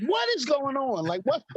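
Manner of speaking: high-pitched disbelief, close to laughing; baffled, voice jumping up; incredulous. Retold or imitated. Dad hears his young daughter hurt a teenage boy, incredulous.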